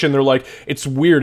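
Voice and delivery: ordinary talk, nothing marked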